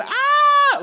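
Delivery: very high, loud mock shriek; kids' scream. Shepherd kids screaming a third time, shriek. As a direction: shriek at the top of your voice. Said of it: "They said".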